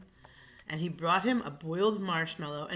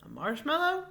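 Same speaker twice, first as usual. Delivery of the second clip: surprised question; 9 semitones higher than usual. Acted. Waiter surprised.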